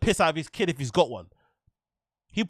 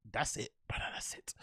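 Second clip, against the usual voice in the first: whisper; 7 semitones lower, 16 dB quieter than usual. Real, not acted. Sudden whisper after rant.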